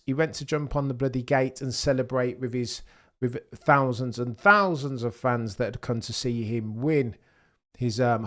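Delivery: normal talking voice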